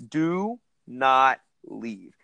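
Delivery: slow, deliberate do not leave; emphatic order. Retold or imitated. Telling the Uber driver firmly not to leave, slow and stern. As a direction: spell out every word of do not leave as a firm command. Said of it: "it would be in size Arial seventy-two font".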